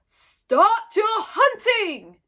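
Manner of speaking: very slow, loud, high yell. A narrator's reading. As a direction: bellow the start command. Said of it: "yelled Gobber the Belch".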